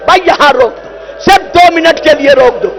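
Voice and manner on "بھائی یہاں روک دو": usual speaking voice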